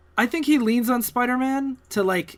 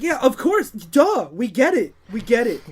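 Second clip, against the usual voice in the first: mock exasperation; 3 semitones higher, 5 dB louder than usual. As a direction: stretch it out as if painfully obvious. Mocking heavy-handed patriotic symbolism.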